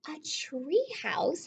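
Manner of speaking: slow awed whisper; hushed amazed question. Acted. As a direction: breathe it like a wonderful secret. Excited reaction to tree house.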